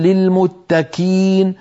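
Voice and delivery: "للمتكين" with the qaf pushed forward into a kaf, slowly; slow wrong "للمتكين" with a k sound; qaf turned to kaf. Shown as a demonstration. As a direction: demo the error "للمتكين", kaf instead of qaf. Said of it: "فلما نقول".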